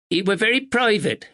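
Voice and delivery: a proper village neighbour, a touch anxious; spinster neighbour. A narrator's reading. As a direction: speak as a dutiful northern spinster. Miss Bennet voiced.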